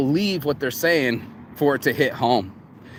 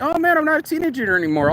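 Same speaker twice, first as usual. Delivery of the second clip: sore self, mocking; 12 semitones higher, faster than usual. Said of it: "I'm like".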